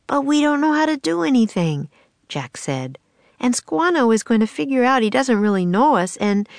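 fretting that they'll be found out; worried boy. Acted. Jack's worried lines, higher pitched.

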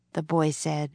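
plain reading voice

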